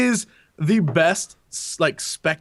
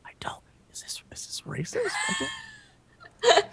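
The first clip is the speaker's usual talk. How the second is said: whispered, halting disbelief; hushed, uneasy muttering to oneself; hesitant whisper; 8 dB quieter, slower than usual. Acted. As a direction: whisper it as if afraid to say it. Acting out an unsure player's reaction.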